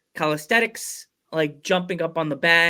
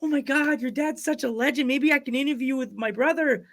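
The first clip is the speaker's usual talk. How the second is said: raised, breathless fan gush; starstruck gushing; 9 semitones higher than usual. Retold or imitated. Sister gushing again, retold.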